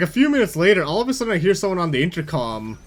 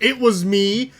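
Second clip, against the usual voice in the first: higher, forceful mock yell; retold angry outburst, pitched up; imitating yelling cashier; 6 semitones higher, 3 dB louder than usual. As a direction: snap back like an irate head cashier. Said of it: "starts yelling at me".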